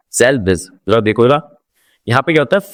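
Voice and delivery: ordinary talk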